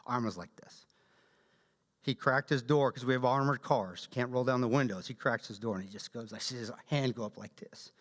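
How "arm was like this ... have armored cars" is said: ordinary talk, nothing marked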